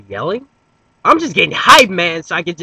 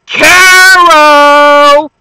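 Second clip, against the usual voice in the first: yelling the name; 12 semitones higher, 16 dB louder than usual. Acted. Teasing his friend by yelling the hated name, loud. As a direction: yell the name, much higher and louder. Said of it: "what happens if I yell".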